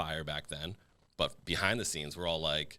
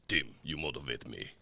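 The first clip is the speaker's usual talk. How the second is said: lower, softer mock game-character voice; Street Fighter character; 5 semitones lower, 5 dB quieter than usual. Teasing the friend's accent as a game clip, low Street Fighter voice. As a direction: parody a fighter's select-screen clip, pitched down. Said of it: "like a Street Fighter".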